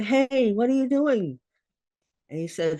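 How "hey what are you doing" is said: high, loud call across the kitchen. Retold or imitated. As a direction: call out to him, tense and high. Mother calling to son with machete.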